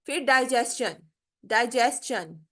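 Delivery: slow डाइजेशन with s-ch blend; drawn out डाइजेशन, s-ch in the middle; slow model word. Shown as a demonstration. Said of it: "स और च दोनों का mixture". (धीमे से डाइजेशन, स और च मिलाकर)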